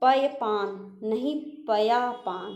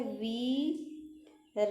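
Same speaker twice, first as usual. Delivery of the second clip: वीरहिणी stretched slowly and softly; वीरहिणी dictated slowly; slow dictation; 5 dB quieter, much slower than usual. Shown as a demonstration. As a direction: say वीरहिणी slowly while writing. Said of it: "ऐसे".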